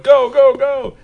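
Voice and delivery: high, loud urging "go, go, go"; urgent boss shouting. Retold or imitated. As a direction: hurry him out the door. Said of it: "They're like".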